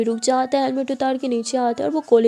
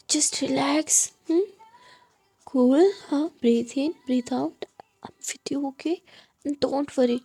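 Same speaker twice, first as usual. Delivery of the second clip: tender reassurance; 2 semitones higher, much slower than usual. A narrator's reading. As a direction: guide an anxious partner to breathe calmly. Calming a worried partner, breathing. (धीरे, प्यार से दिलासा)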